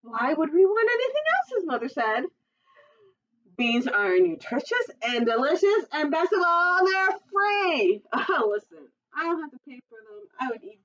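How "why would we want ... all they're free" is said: much higher, louder chirpy mother voice; a mother cheerily selling beans to her son; cheerful mother. Acted.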